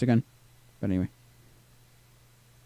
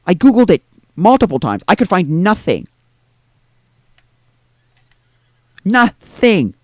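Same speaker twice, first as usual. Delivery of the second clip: louder, insistent mock outburst at know-it-alls; exasperated retort; 2 semitones higher, 4 dB louder, slower than usual. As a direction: hammer home nothing and zero. Retorting to forum gatekeepers.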